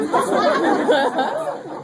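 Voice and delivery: high burst of laughter. Real, not acted. Speaker to the audience, cracking up with high laughter. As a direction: crack up at the story. Strangers sharing their gynecological secrets.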